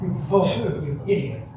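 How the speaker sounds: mock angry shout at the apprentice; retold yell. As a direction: snap the insult like a stern master. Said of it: "yells at him".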